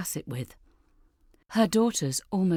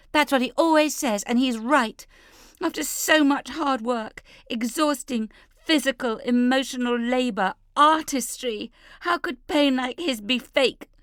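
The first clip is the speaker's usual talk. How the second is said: high, loud furious outburst; exploding in anger; 9 semitones higher, 5 dB louder than usual. Acted. Leah explodes at her family, high and furious. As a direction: let the anger burst out. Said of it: "Leah explodes".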